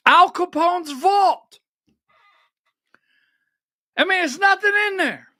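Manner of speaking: rising exasperation. Real, not acted. Capone vault letdown, building outrage.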